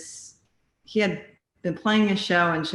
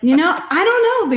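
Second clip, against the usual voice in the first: laughing through the words, pitched up; giggling while brushing off the question; 12 semitones higher, 8 dB louder than usual. Real, not acted. Singer laughs off the host's dating-app joke, giggling.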